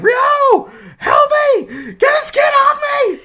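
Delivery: very high, loud cry for help; mock panicked shouting. [name] calling Ryo to pull the kid off, mock panicked shout. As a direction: shriek for rescue in a hammed-up squeal.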